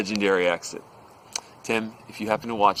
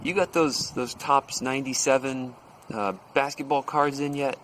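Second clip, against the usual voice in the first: lightly voiced salesman, higher pitch; casual small talk; 7 semitones higher than usual. Telemarketer slyly chatting up the shop owner, imitated casual higher voice. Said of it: "And then he goes".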